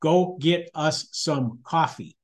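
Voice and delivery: slow, word-by-word wifely order; real wifey voice. Retold or imitated. Wife overruling husband's excuse about lines, real wifey voice. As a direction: spell it out slowly, leaving no room to argue. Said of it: "said in the real wifey voice".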